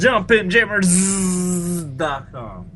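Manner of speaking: high, very slow drawn-out announcer; hammy announcer. Acted. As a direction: stretch the name like a cheesy ad. Hamming the name.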